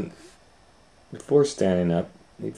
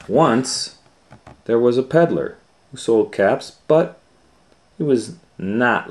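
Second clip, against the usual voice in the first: bright, lifted read-aloud start; storyteller opening; 4 semitones higher, 7 dB louder than usual. A narrator's reading. Reading to children, a lively story opening. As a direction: open the tale like reading to kids.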